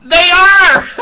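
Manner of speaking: high, loud mock cry of the wife; imitating his wife's outburst; mock yell. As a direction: blurt it out as the upset wife. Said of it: "she looks at the ground and goes".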